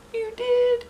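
put-on high small girl's voice asking; sniffly little girl, surprised; child voice wiping her eyes; tearful girl. A narrator's reading. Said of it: "Emily said, wiping her eyes".

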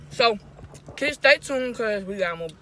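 fries against mouth; mid-snack speech; talking with fries at lips. Real, not acted. Seen 3: Fries pressed against lips while talking, 0.6–1.7; eats them earlier.

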